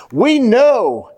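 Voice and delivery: preacher hitting "know" in "we know"; stress on know. Shown as a demonstration. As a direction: lean hard on "know" in "we know". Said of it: "underline that word".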